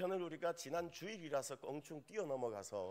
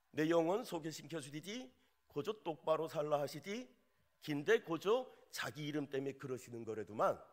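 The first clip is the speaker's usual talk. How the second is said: put-on playful North Korean Pyongan accent; 평안도 사투리로 장난스럽게; Pyongan dialect; 4 dB louder than usual. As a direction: read the psalm like a folksy Pyongan elder. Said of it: "평안도 버전입니다".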